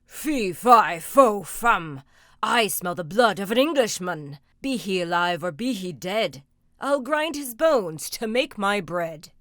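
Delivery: loud booming chant of a giant; ogre's rhyme. Acted. Ogre sniffing out Jack, booming chant. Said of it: "began the real ogre's rhyme".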